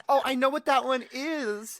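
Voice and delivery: mock confident claim; bluffing know-it-all. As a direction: pipe up as if you're in the know. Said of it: "acts tough".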